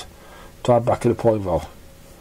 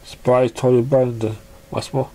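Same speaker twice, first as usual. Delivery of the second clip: ordinary delivery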